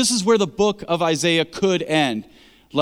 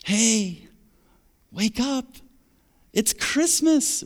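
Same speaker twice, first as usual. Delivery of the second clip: much higher, soft and eager, slowing down; gentle Christmas-morning excitement; 5 semitones higher, 3 dB quieter, much slower than usual. Retold or imitated. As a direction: wake the kids softly on Christmas morning. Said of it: "the kind of tone that you would have".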